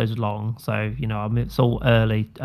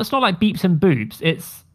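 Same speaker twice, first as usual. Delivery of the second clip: ordinary delivery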